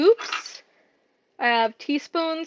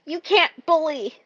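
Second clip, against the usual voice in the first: higher, lightly put-on reprimand; mock scolding; 4 semitones higher than usual. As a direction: imitate an automated telling-off. Voicing the platform's scolding message.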